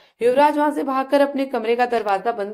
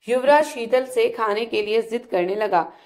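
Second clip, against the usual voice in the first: plain reading voice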